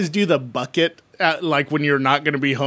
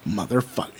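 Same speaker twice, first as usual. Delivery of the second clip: muttered curse; 8 semitones lower, 7 dB quieter than usual. Retold or imitated. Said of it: "I was like".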